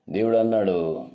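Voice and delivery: usual speaking voice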